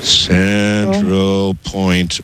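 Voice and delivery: very slow, drawn-out words; writing while speaking. Real, not acted. Caller repeating the host's address as she writes, very slowly. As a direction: stretch each word out as you write it down.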